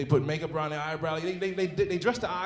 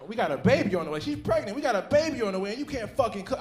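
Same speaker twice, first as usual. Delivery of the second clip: high, loud complaint; loud mock exasperation; mock outrage; 5 semitones higher, 5 dB louder, faster than usual. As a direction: rant at her about the cooking. Scolding pregnant partner in head.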